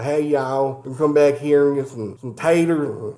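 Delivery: hammed-up backwoods Southern accent; deep Southern accent. Showing how Americans sound abroad, deep Southern accent. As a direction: put on a country Southern accent, not the cute kind. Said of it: "like, a deep Southern accent here".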